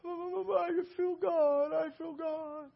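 high, soft, very slow quavering moan; imitated tearful wail. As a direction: draw it out high and quiet, as if overwhelmed. Acts out overwhelmed woman.

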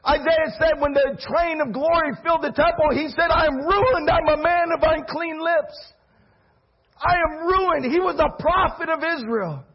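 high, loud, driving sermon delivery; impassioned pulpit proclamation; full-force preaching. Acted. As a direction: preach it hard to the whole church, pitch way up.

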